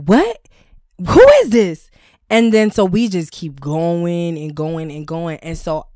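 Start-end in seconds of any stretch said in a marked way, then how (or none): 0.0-1.9 higher, louder puzzled answer on the phone; confused boy on phone. Retold or imitated. Prank-called boy asking who is calling, higher and louder. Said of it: "And he's like".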